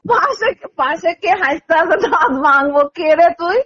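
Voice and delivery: usual speaking voice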